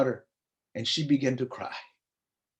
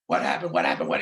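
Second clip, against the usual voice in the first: much lower, a worried father's demand; alarmed father; 9 semitones lower, faster than usual. Retold or imitated. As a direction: drop the voice low, like a startled dad. Father reacting to his daughter.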